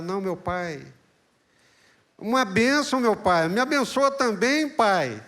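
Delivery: high, loud anguished begging; weeping plea. Acted. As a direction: cry out to your father for a blessing. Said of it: "ele começa a chorar". (Esaú chorando e implorando ao pai a bênção)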